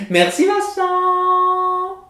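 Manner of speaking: high, slow sing-song mom voice; imitating mom. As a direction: sing it out like a mother prompting a child. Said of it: "Est-ce que j'imite ma mère?".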